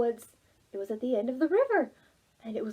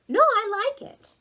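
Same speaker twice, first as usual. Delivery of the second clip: put-on young Oliver; 10 semitones higher, 6 dB louder than usual. Oliver reassures bug.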